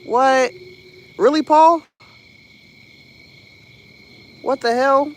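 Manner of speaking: loud, high, delighted disbelief; real excited surprise on reading a donation; surprised. Real, not acted. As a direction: shout it like you can't believe the gift.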